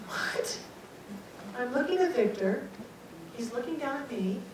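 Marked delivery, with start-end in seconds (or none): 0.1-0.6 whispered, dumbfounded question; hushed disbelief; stunned whisper. Retold or imitated. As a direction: barely get the word out. Stunned, speechless reaction acted out.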